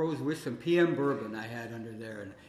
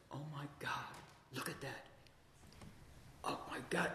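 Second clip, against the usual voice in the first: 1 hushed awe; 16 dB quieter, faster than usual. Retold or imitated. Spotting something under the house.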